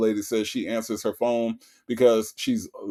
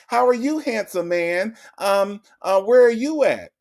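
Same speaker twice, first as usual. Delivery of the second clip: high, eager flirting voice; flirty mock Sonya; 8 semitones higher than usual. Host imitating Sonya sweet-talking the warranty caller, high flirty coo. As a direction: sweet-talk the scammer, pitched way up. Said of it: "This is how she talked to the scammers".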